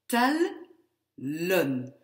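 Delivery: tellen split as a loud tel, pause, len; short, closed syllable. Shown as a demonstration. Teacher showing the double L, tellen in two separate syllables. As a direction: break tellen after the L. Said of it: "Pour garder ce son court".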